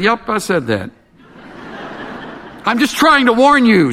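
a hint of a smug bird; parrot voice. A narrator's reading. Parrot answers the burglar, chuckling lightly.

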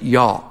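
y'all with no L at the end; Southern "y'all". Shown as a demonstration. Native teaching the word for two people, y'all without L. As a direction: drop the L, open-ended y'all. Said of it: "Don't put an L on it".

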